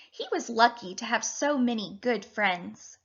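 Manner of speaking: ordinary talk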